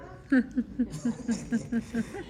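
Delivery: a short, high, sheepish laugh at his own tongue slip; embarrassed laugh. Real, not acted. Preacher laughing at his own stumble, sheepish chuckle.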